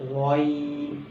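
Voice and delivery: غین rounded at first, then opened, from the throat; throaty غین; throaty ghain. Shown as a demonstration. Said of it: "شروع میں گلائی آئے گی".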